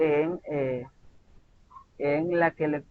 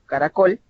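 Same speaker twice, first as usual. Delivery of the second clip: caracol with a lifted, rising final -col; stress on last syllable; 3 semitones higher, 4 dB louder than usual. Shown as a demonstration. Teacher has students notice the word, stressing the end of "caracol". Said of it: "tienen la sílaba tónica en la última sílaba".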